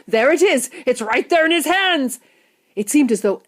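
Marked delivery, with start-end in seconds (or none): 0.1-2.2 high, loud shopkeeper beside himself; excited screaming. A narrator's reading. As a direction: shout the news to everybody, thrilled. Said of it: "screamed the shopkeeper".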